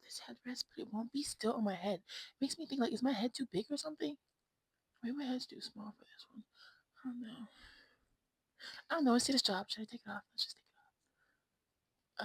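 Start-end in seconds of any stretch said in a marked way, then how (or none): none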